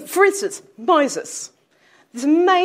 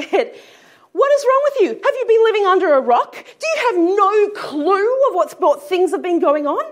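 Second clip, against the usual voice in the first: loud, much higher, exasperated shouting; angry outburst; 7 semitones higher, 6 dB louder than usual. Retold or imitated. Claire Pass snapping at the clueless stranger, loud exasperated outburst. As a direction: let the disbelief explode at the stranger. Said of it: "Claire Pass was getting really angry".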